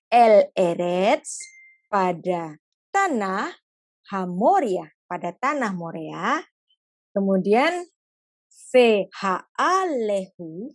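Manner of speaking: very slow, drawn-out reading; God slowly giving a painful command; slow gentle. Acted. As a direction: spell out the heavy order slowly. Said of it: "Ngomongnya pelan-pelan".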